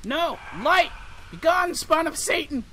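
high, loud mock banishing; shouting defiance. Real, not acted. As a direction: yell it out like an exorcist.

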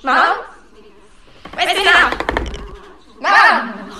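calling loudly through the house. Real, not acted. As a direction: shout for mum from another room. Calling out to mum.

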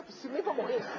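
laughing through the words; half-laughing disbelief. Retold or imitated. Laughing while retelling.

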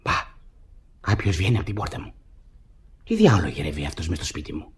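soft, low muttered aside to himself; quiet suspicious aside; hushed aside. Acted. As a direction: keep it hidden, under your breath. Spots an intruder, hides.

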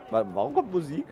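usual speaking voice

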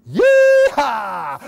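loud high yeehaw whoop; cowboy whoop. Acted. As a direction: whoop like a rodeo rider. Riding goat on ceiling.

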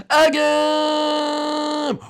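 high, drawn-out put-on war cry; high, stretched-out, gleeful yell; battle cry. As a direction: call it like springing an ambush. Egg ambush on the teacher.

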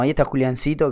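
ordinary delivery, nothing marked

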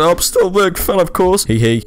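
high, mock-sweet teen girl voice; mock young girl. Man posing as an underage girl reads her messages, high girly voice. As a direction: gush it like a giddy fangirl. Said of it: "I'm trying to impersonate a young girl".

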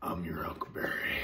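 a hint of Doc Holliday, hushed and whispered; Doc Holliday quote. Quoting his favourite movie to viewers, in a lightly whispered Doc Holliday voice. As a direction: breathe the line like a cool gunslinger, lightly voiced. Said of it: "That was Doc Holliday".

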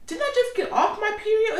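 high, laughing exasperation; laughing disbelief. Real, not acted. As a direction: laugh through the complaint in disbelief. Exasperated about her period.

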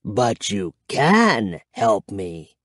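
low, slow vampire voice; deliberate, menacing undead voice; declaring vampire. A narrator's reading. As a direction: declare it slowly and deeply. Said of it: "he declared".

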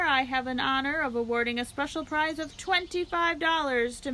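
lightly voiced loudspeaker address to the crowd; a hint of a county fair announcer. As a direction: present the pig like a showman.

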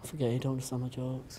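unvoiced, hushed jab; sulky whispered aside. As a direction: whisper the dig under your breath. Muttered aside to friend.

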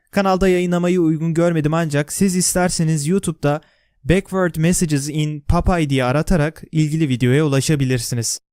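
ordinary talk

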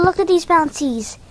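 normal talking voice

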